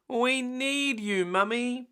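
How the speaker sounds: put-on high, slow little-girl plea; Peppa's voice. Peppa begging Mummy for help, high and slow. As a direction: plead like a small piglet.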